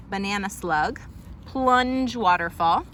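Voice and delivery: usual speaking voice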